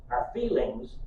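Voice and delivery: ordinary talk, nothing marked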